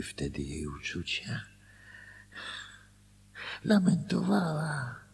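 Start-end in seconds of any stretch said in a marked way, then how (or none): 3.6-5.0 a much higher, louder mocking wail; mocking lament. Husband mocking his wife's complaints, high mock wail. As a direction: parody her wailing in a high voice.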